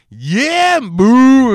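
loud, high yeah and boo; hammed-up imitated yelling; mock villain shouts. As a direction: holler both like catchphrases. Re-enacting both villain cries.

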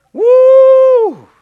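high, loud whoop; whooping holler. Real, not acted. Preacher whoops high and loud to the congregation. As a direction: let out a joyful high whoop. Preacher's exclamation mid-sermon.

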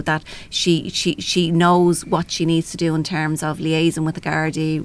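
ordinary talk

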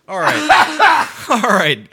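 loud, high laughing words; laughing as they wrap up the show; real laughter. Real, not acted. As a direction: try to speak through a giggle fit. Cracking up at wrap-up.

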